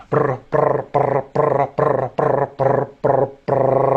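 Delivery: rolled brr pulses held on one steady pitch; voiced trill drill, short bursts then a long one; rhythmic trilled "brr" on a single note. Shown as a demonstration. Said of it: "and to a brr sound".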